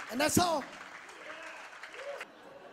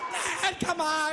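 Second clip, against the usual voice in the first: usual speaking voice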